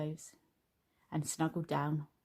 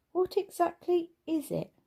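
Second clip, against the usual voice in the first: much higher, wary question; nervous question; 11 semitones higher, 4 dB louder than usual. A narrator's reading. Mum dreading the burnt lunch, high nervous question. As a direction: ask fearing the answer. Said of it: "asked Missus Large nervously".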